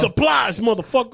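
high, loud mock catchphrase; guy from Dexter. Comic echoing a friend's TV quote, high punchy impression. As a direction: pounce on it like a TV tough. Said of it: "that guy from Dexter".

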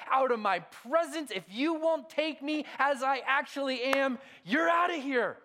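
훨씬 높고 크게 외치는 과장된 분노의 하나님; 일부러 과장해서 호통치듯; hammed-up angry God. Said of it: "Yahweh doesn't come alongside of Jonah and say".

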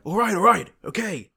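high, loud mock tough-guy line; hammed-up action hero. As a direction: ham it up like a cheesy movie cop. Acting out the cheesy alternative line.

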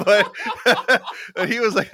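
usual speaking voice

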